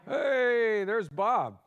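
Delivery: loud, high, drawn-out hey; excited call-out. Real, not acted. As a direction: hail the guest as he appears. Greets guest appearing on the line.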